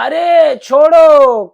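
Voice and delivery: high, loud drawn-out cry; pained protest. Acted. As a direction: yell it, stretching each word to be let go. Boy grabbed, cries to be released.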